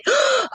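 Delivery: sharp high gasp of delight. Pretend. Imagined customer gasping at her blanket, high and delighted. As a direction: gasp like a thrilled gift recipient. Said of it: "You want somebody to say".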